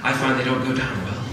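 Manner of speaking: normal talking voice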